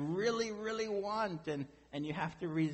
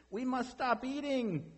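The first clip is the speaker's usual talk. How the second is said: high, urgent outcry from a story character; crying out; 11 semitones higher than usual. A narrator's reading. Toad to Frog while eating cookies, high-pitched crying out. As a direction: cry it out high, without extra volume. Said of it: "cried Toad as he ate another".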